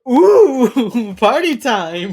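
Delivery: high, loud, drawn-out whoop; laughing, hammed-up glee; mock party excitement. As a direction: cheer like the party just started. Acting out delight at the music.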